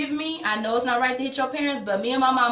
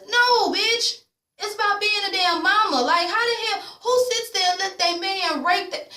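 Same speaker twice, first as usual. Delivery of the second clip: indignant rant; 10 semitones higher than usual. Real, not acted.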